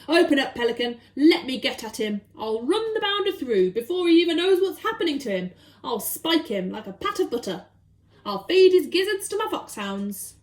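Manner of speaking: loud fierce bluster; blustering Duke. Acted. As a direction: threaten with gusto. Duke threatens the burglar.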